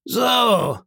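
high-pitched indignant cry; exclaiming. Acted. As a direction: burst out at the news. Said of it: "rief Silver".

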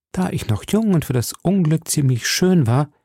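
ordinary delivery, nothing marked

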